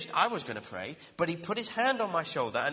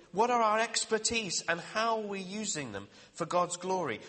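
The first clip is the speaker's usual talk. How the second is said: ordinary talk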